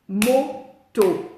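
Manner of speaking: "Moto" split into two beats, loud; MO, pause, TO, firmly separated; final-syllable stress. Shown as a demonstration. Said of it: "les mots s'accentuent sur la dernière syllabe".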